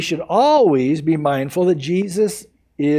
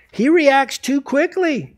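ordinary talk

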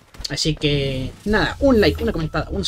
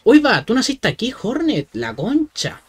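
excited; 8 semitones higher, 4 dB louder, slower than usual. Real, not acted. Surprised discovery.

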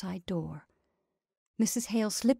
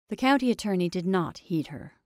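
usual speaking voice

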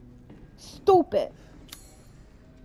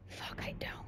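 breathy whisper under the breath; whispered curse; 20 dB quieter than usual. Real, not acted. Player finding no inventory room, whispering a curse. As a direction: hiss it to yourself.

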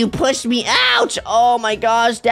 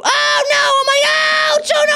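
pained yell; 11 semitones higher than usual. Real, not acted. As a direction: cry out as you land hard. Jumps down and gets hurt.

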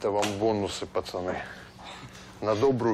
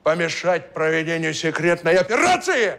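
stern officer voice; 5 semitones higher, 7 dB louder than usual. Acted. Kaban poses as a major.

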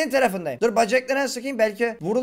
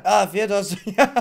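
normal talking voice

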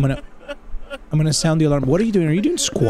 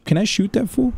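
normal talking voice

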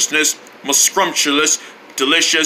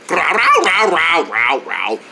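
dog barks; slower than usual. Acted. Dog character barking happily.

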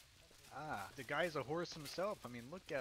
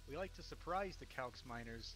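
ordinary talk, nothing marked

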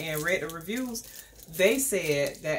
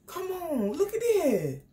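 pitched up, delighted show-off; high excited coaxing; 9 semitones higher than usual. Real, not acted. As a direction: show it off with glee. Showing off perfume.

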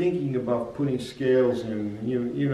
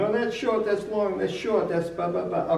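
higher, mock bandleaders barking orders; retold yelling; 7 semitones higher than usual. Teacher recalls old players yelling at him, lightly mocked. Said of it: "they yelled at me constantly".

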